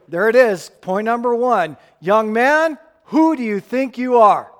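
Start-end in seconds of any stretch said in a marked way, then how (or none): 2.0-4.5 contemptuous, louder and higher; derogatory. Retold or imitated. Priests scornfully challenging John the Baptist. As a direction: look down your nose and sneer. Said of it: "it's just that derogatory".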